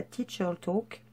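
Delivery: English words in a French accent, slowly; super English accent. Real, not acted. Vlogger naming a scrapbook page for viewers, French-accented English. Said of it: "avec mon super accent anglais".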